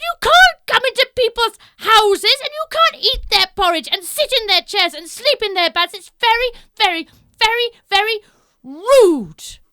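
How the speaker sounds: very loud high telling-off; loud high stern telling-off; scolding bears. Acted. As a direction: scold her like naughty child.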